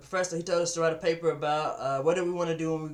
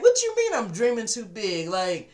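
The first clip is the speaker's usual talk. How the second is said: higher and louder, indignant disbelief; offended outburst; 4 semitones higher, 5 dB louder than usual. Retold or imitated. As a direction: push back like you've been insulted. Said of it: "I got offended".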